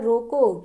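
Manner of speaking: रोको alone, cut off by a comma pause; pause after रोको. Shown as a demonstration. Teacher repeats the example for students, pause after रोको. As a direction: say रोको as a complete command, then stop. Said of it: "कामा लगा है".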